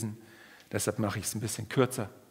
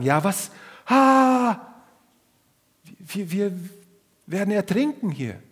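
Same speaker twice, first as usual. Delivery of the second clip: high, loud panicked cry; frightened screaming; 8 semitones higher, 6 dB louder, slower than usual. Retold or imitated. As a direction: scream that you're drowning. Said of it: "so schreien wie die Jünger".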